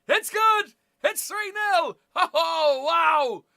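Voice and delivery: high, loud celebrating; delighted fan cheering the first points; excited cheer. Real, not acted. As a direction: shout as the kick goes over. Celebrating an early penalty kick.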